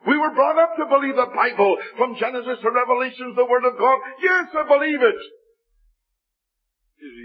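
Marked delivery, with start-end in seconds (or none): 0.1-5.1 high, rising insistence; insistent protest. Retold or imitated. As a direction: protest more and more firmly to your sister. Half annoyed, insisting to his sister.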